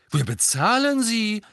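high, loud protest; indignant. A narrator's reading. Fred defending the testing to Hermine, indignant. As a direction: protest as if wrongly accused. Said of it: "sagte Fred entrüstet".

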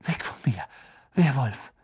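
breathless whisper rising on Werwolf; gasped fear. Acted. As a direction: gasp it out in pain and fear. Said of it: "doch Ron japste".

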